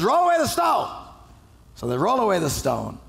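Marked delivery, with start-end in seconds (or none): 0.0-0.9 higher, louder command; Jesus repeating his order more forcefully; firmer repeat. Retold or imitated. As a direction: insist the second time. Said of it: "He says".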